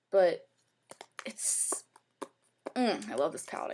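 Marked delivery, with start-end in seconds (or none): none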